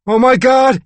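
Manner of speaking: high, loud cry of shock; startled high-pitched outburst; panicked cry. A narrator's reading. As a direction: cry out as if jolted awake. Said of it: "he cried".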